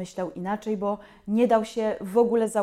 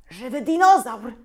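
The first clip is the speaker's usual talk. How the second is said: excited; 3 semitones higher, 7 dB louder than usual. A narrator's reading. Teacher thrilled by dinosaur.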